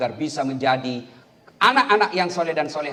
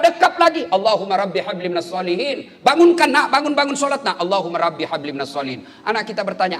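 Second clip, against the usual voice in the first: normal talking voice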